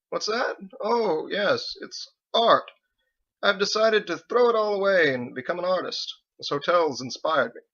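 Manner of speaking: high, playful evasive joking; teasing husband. Acted. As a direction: brush it off with a grin.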